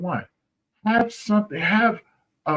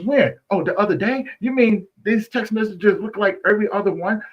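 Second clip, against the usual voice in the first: mock disbelief; 8 semitones higher, 4 dB louder than usual. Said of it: "he goes".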